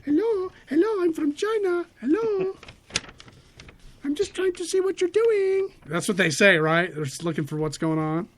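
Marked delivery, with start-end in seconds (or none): 0.1-5.7 much higher put-on caricature voice; high silly voice of a spy from China; mock spy voice. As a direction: squeak it out as a cartoon spy.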